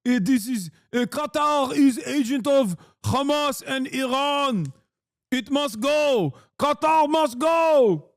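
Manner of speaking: put-on a sneering Israeli accent caricature of a Knesset member; mock Israeli accent. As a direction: parody a Knesset hardliner in a thick Israeli accent, drawing out "must go". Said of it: "talking like a reactionary Israeli member of the Knesset".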